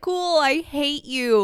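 high, drawn-out mock sweetness; mock resentment. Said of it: "And it's like".

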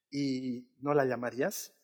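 drawn-out worried question; a hint of an anxious mother; lightly voiced mother. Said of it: "mi mamá me dice".

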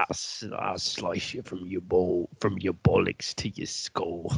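put-on English accent sinking to a soft near-whisper; mock London rapper. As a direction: menace trails into a hushed, laughing threat. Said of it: "the English rappers that are coming out".

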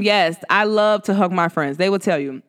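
usual speaking voice

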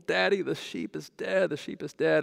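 high-pitched mock wail; hammed-up kid's distress; imitating inconsolable child. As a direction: plead to daddy like an upset little boy. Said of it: "Joshua was inconsolable".

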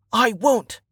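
high, sudden exclamation; defiant resolve. A narrator's reading. Archer answering himself as he draws his bow, defiant.

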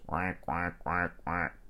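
soft, low duck quacks. Shown as a demonstration. Storyteller shows children what a duck says, soft quacking. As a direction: imitate a duck quacking quietly. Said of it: "a duck says".

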